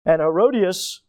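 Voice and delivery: ordinary talk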